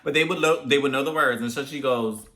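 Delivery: usual speaking voice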